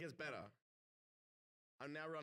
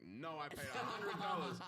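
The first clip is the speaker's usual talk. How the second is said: louder retold protest breaking into laughter; defiant protest; 3 semitones higher, 6 dB louder than usual. Drunk man refusing to give it back to worker, mock defiant protest. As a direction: act out standing your ground, half laughing.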